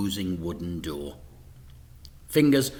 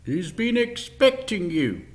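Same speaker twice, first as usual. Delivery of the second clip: higher, louder prim servant; a prim butler over the intercom; 10 semitones higher, 6 dB louder than usual. A narrator's reading. Butler to the crooks at the gate, prim.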